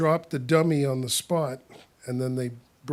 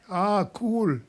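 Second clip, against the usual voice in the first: Jamaican accent; 6 semitones higher, 4 dB louder than usual. Shown as a demonstration. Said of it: "Jamaican mixed together".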